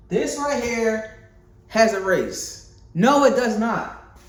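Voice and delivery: slow, pointed, incredulous rebuttal; indignant disbelief. Real, not acted. Indignant reply to race critics.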